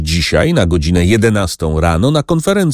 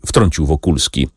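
plain reading voice

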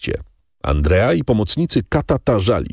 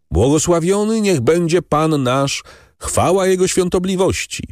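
high, louder ringing call to the crowd; loud clear proclamation; 10 semitones higher than usual. A narrator's reading. Brotherhood elder announcing the pardon, loud and clear. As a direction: proclaim it clearly over the square. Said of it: "zawołał głośno i wyraźnie".

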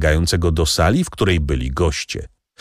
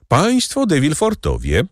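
high-pitched announcement of guests; servant's formal call, pitched high; announcing call; 10 semitones higher than usual. A narrator's reading. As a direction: call out the arrivals on a raised pitch. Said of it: "zawołał baptysta".

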